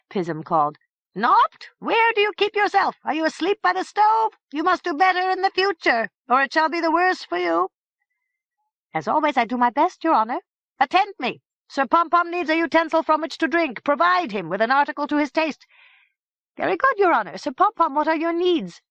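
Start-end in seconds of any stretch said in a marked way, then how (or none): 1.1-7.7 high, loud imperious head scolding the servant; high head calling Nopt, impatient and scolding; Pism calling. Acted. 8.9-15.7 high, eager servant voice; high servant answering, obliging; Nopt the servant. Acted. Nopt replies to his master. 16.6-18.8 high, eager servant voice; high servant answering, obliging; Nopt the servant. Acted. Nopt serves Sir Pompom.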